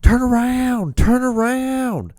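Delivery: very high, drawn-out mock yell; imitated screaming. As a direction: scream it out slowly like the man in the street. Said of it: "he was just screaming".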